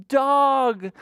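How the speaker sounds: very high, loud happy announcement; spring the surprise on little kids; excited reveal. Pretend. Storyteller revealing the duck to children, excited reveal.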